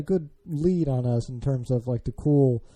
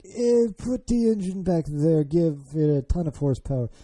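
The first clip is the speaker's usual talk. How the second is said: put-on terrible French accent; 3 semitones higher than usual. Host mocking laissez-faire French car designers, bad French accent. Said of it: "That's a terrible French accent".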